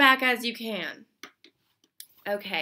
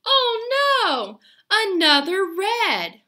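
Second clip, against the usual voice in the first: mock dismay; 9 semitones higher, 8 dB louder, slower than usual. Pretend dismay at another red.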